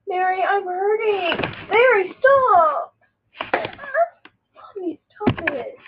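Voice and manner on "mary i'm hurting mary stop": high whining protest, getting louder. Pretend. Play-hurt, begging friend to stop, rising loud protest. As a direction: cry out for it to stop, building.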